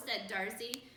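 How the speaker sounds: ordinary delivery, nothing marked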